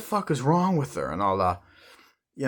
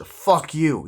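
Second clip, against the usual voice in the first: loud, higher fuck you; angry curse; 6 semitones higher, 6 dB louder than usual. Retold or imitated. Donnie telling off the speaker before storming out, loud. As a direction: spit it at him.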